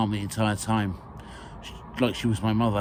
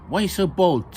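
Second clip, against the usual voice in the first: higher, louder comic teasing; comical tone; 8 semitones higher, 5 dB louder than usual. Acted. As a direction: tease him with a comical lilt. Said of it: "with a comical tone".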